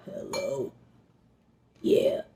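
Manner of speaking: low, soft, croaky just-woke-up voice; groggy monster voice. Retold or imitated. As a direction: answer the phone like a half-dead monster. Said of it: "sounding like a monster".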